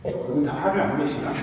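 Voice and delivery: ordinary talk, nothing marked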